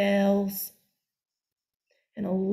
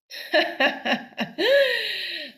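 high, mocking witch cackle; loud mocking cackle; 11 semitones higher, 5 dB louder than usual. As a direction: cackle at the silly girl. Witch laughs at the girl.

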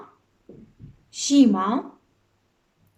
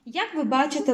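usual speaking voice

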